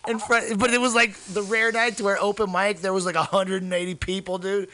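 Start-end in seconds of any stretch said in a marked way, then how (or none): none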